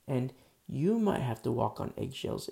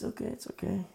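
higher, gentle reassurance; lightly voiced wife; 7 semitones higher, faster than usual. Wife forgiving her husband, gently higher. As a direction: reassure him kindly in her voice. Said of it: "She says".

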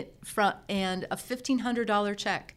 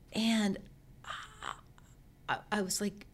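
ordinary talk

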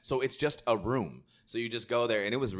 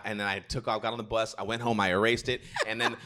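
ordinary talk

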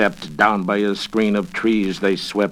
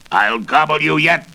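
higher, louder greedy witch; gulping witch threat; 6 semitones higher, 4 dB louder than usual. Acted. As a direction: threaten them with a hungry gulp. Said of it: "she gulped".

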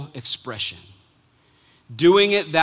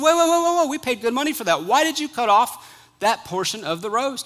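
much higher, fast, urgent; alarmed husband; 7 semitones higher than usual. Retold or imitated. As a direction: stop her in a rush of alarm. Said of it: "He said".

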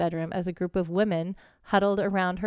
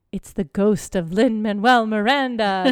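joking ghost voice; 7 dB louder, slower than usual. Retold or imitated. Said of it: "Carol joked".

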